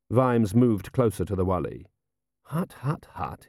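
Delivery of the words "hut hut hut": urgent hiss under the breath. A narrator's reading. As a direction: hiss it softly at someone close by. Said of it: "he hissed".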